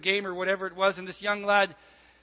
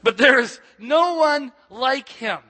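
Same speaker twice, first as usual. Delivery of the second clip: louder, slower, stress on "no"; second try, slow and ringing; bigger second take; 6 semitones higher, 9 dB louder, slower than usual. Shown as a demonstration. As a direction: preach "no one like him" out with full force, leaning on "no". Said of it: "It does get better second time around".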